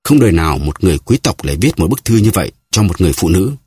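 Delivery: usual speaking voice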